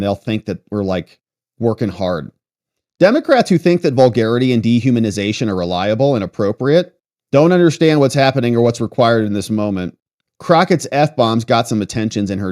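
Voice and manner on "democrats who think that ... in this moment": normal talking voice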